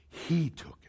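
very soft, low answer; calm revelation. Acted. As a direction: quietly break the news. Calm answer to upset Mary.

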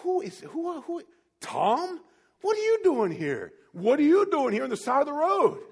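high, incredulous questioning; acted astonishment. Old schoolmates meet on the roadside, astonished. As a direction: fumble for the name, then exclaim.

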